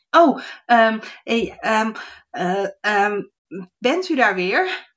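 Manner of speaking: high, stammering gnome voice; flustered gnome. Acted. Gnome surprised to find the fairy again, high flustered call. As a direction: stumble over the words nervously. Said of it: "riep hij".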